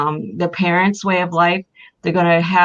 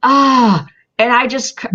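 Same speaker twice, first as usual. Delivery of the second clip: crying; 6 semitones higher, 6 dB louder than usual. Real, not acted. Recalling granddaughter's moment, breaks down.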